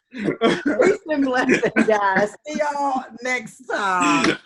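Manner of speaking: high, drawn-out farewell; exuberant sign-off. Real, not acted. Host signs off to the guys watching, exuberant farewell. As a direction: stretch out the goodbye high and big. Signing off to the audience, laughing.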